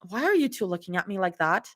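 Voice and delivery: usual speaking voice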